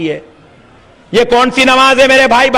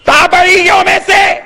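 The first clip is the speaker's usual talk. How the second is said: high, drawn-out shouted demand; full-force preaching challenge; 6 semitones higher than usual. Acted. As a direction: call out the opponents before a crowd. (بلند آواز میں للکارتی خطابت)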